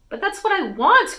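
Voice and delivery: high, loud frustrated outburst; a teenage daughter pleading loudly with her mother, frustrated; frustrated cry. A narrator's reading. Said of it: "cried Allie, frustrated".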